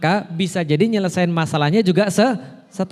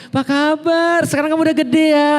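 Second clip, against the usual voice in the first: put-on high, fast child-like greeting; wrong tone for wife; 8 semitones higher, 4 dB louder than usual. Husband mistakenly greeting his wife like a child, high. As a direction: wrongly talk to your wife like a kid. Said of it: "Ketika ketemu istrinya, apa kayak".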